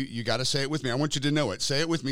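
usual speaking voice

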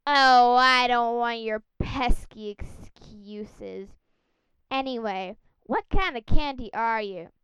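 loud, slow dismissive cop voice; london policeman. Acted. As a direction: brush off excuses like a gruff bobby.

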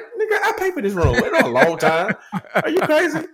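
high, laughing mock outrage. A father to his son wanting alone time, laughing and outraged. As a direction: bluster at the son through laughter.

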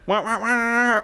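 high cartoonish animal wail; chinchilla imitation. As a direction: make the silly chinchilla noise from an ad. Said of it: "that's what chinchillas sound like".

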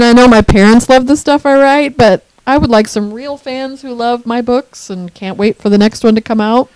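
ordinary talk

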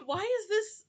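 high-pitched baffled complaint, acted out; mock exasperation. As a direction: play up the disbelief, pitched high. Acts out exasperation at bad accents.

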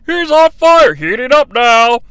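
excited game commentator; NBA Jam announcer. Retold or imitated. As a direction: hype it like a basketball video game. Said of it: "just like the N B A Jam announcer".

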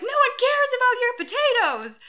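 high, loud fed-up retort; retold outburst at full pitch; exasperated yell. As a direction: shriek it as the last straw.

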